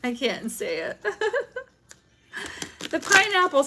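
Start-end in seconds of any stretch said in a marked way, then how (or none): 1.1-3.6 high, loud laugh into the words; giggling before naming the pineapple. Real, not acted. Laughs at swinger hint.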